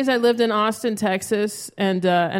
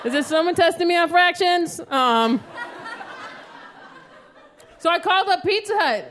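ordinary delivery

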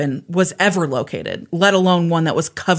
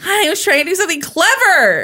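laughing while defending herself; high laughing whine; 11 semitones higher, 4 dB louder than usual. Real, not acted.

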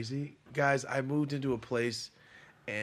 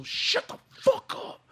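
very high, slow, strained outburst; mock strained yell; 3 dB louder, slower than usual. Telling the noisy crew off, mock strained yell. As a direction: drag out a squeezed, exasperated scream. Acts out yelling at neighbours.

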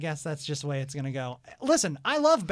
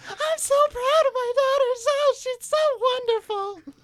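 much higher, louder gushing proud-mom voice; impression of Debbie; 5 dB louder than usual. Host imitating the guest's mother gushing about her daughter, high and loud. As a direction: gush like a doting mother bragging about her girl. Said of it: "almost a dead-on impression of my mom".

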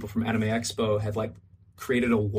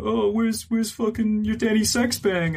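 higher-pitched imitation of a passer-by; mock passers-by; 8 semitones higher than usual. Passers-by calling to a costumed cosplayer, imitated in a higher voice. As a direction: put on a high con-goer voice.

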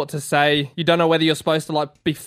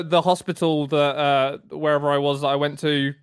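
ordinary delivery

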